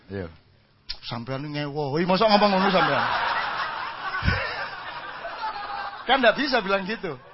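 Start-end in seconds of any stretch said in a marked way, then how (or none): 3.1-6.0 airy laugh rising up high; laughter at his own punchline; high breathy laugh. Real, not acted. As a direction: let a real laugh spill out. Laughing at own joke about God.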